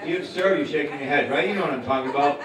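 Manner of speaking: ordinary talk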